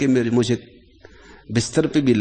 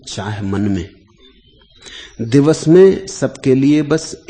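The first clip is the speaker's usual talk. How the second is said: poem recitation; 5 dB louder than usual. Acted. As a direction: recite the poem in rhythm, lifting opening lines louder. Recites a poem within discourse. (लय में धीमा कविता-पाठ)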